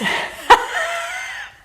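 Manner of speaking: a real laugh she can't hold in; burst of laughter. Real, not acted. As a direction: crack up out of nowhere. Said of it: "I don't know why I'm laughing".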